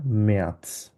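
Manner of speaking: ম্যাথস as slow "me-ats", no R; silent R. Shown as a demonstration. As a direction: say ম্যাথস slowly with the R dropped. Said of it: "আরটা এখানে থাকবে না". (ম্যাথস, র ছাড়া "মেআৎস")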